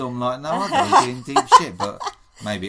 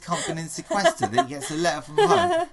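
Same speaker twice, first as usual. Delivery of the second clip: laughing outrage; 9 semitones higher, 6 dB louder than usual. Real, not acted. Ranting about housemate crying.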